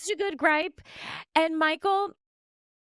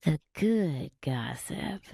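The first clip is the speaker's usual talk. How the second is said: much lower, slow owl-like hoot; owl voice on request; 10 semitones lower, 4 dB quieter, slower than usual. Shown as a demonstration. Guest copies the host's owl sound, low and slow. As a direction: drawl it low like a hooting owl. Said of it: "Come on, try it for me".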